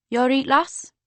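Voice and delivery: a burly Geordie bouncer, gruff and friendly; strong Geordie accent. A narrator's reading. Bouncer greets the girl at the club door, Geordie accent. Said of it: "said the bouncer in a strong Geordie accent".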